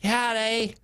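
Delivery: put-on high-pitched little kid voice; little boy. Nine-year-old runs up to dad, little boy voice. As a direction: call out in a high child's voice. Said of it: "and he runs up".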